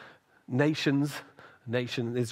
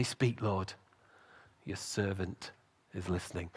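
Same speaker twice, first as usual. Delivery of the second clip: humble prayer; 5 semitones lower, 5 dB quieter than usual. Acted. Humble reply to God.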